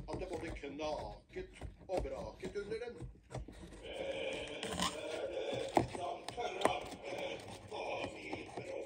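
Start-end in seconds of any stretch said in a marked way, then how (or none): 3.8-8.8 very slow, loud troll roar. Acted. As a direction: drag it out like a troll. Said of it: "skrek trollet".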